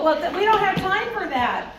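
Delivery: a hint of a flustered organized teacher; flustered hurry. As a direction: brush off the question in a hurry. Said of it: "who go".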